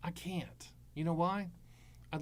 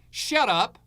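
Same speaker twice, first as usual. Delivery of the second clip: loud, blunt cut-off; telling the hybrid salesman to shut up, loud; scornful shout; 5 semitones higher, 9 dB louder than usual. Pretend. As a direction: slam the door on him.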